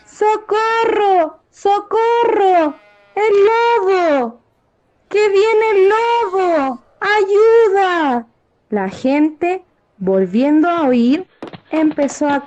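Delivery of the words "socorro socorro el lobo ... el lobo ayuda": high, slow mock call for help; fake cry for help. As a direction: call out the false alarm, drawn out. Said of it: "volvió a gritar".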